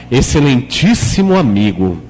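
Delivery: put-on formal tone. Shown as a demonstration. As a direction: greet a friend with mock ceremony. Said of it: "Você muda o tom de voz".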